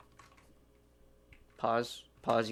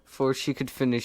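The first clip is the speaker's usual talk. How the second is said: usual speaking voice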